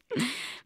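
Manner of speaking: soft, short laugh; amused chuckle. Acted. Mark amused by the hairdryer story, soft chuckle. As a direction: chuckle quietly to yourself. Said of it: "he said, laughing".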